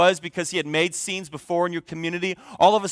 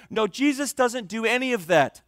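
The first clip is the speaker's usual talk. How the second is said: ordinary talk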